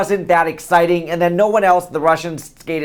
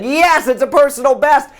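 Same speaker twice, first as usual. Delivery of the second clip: mock cheer; 11 semitones higher, 3 dB louder than usual. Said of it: "and we're like".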